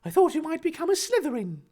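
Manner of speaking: high-pitched character voice; acted character voice. Reader voicing a fanfic character's dialogue, high acted voice. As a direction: act it out in a high register.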